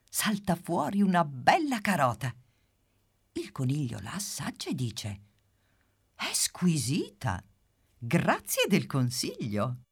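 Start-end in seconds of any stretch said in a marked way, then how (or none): 6.2-9.8 slow whisper of pleasure; whispered delight. Acted. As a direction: savour it in a hushed voice. Rabbit savours the carrot.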